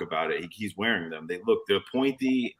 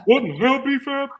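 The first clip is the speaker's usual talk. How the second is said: high, loud, ranting outrage; mock outrage; 7 dB louder than usual. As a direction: bellow it in fake shock. Repeated mock outrage greeting.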